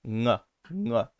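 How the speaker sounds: ng, ng said as a back nasal hum; ng sound. Shown as a demonstration. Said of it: "where you can make the sound, uh, N G".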